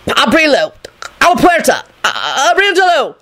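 high, urgent incantations; desperate spell-casting. A narrator's reading. Lupin trying words on a locked desk, desperate.

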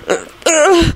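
high strangled groan; pained, strangled noises; strangled croak. A narrator's reading. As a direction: choke out a croaking uh. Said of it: "croaked out in a strangled tone".

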